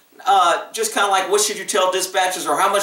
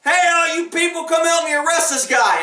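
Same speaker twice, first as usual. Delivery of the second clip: hollering; 8 semitones higher than usual. Retold or imitated. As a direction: holler it out to everybody in the room. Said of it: "hollering in a bar".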